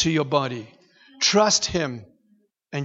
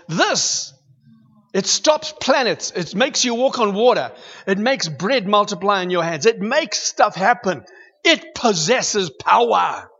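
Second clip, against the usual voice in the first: preaching at full force; 3 semitones higher than usual. Acted. Preacher exhorts the church about the word's power, fervently. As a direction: drive the point home to the congregation.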